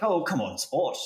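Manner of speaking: put-on old-time British diplomat accent; 1920s British. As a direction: put on a twenties British colonial voice. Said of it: "nineteen twenties British way".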